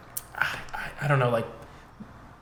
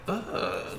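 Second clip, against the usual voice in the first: high, soft shuddering groan; breath-heavy "ugh" of cringe; cringing shudder; 10 semitones higher, 8 dB quieter than usual. Retold or imitated. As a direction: recoil in secondhand embarrassment. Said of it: "that, like, shiver that you get".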